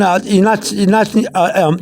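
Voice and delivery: usual speaking voice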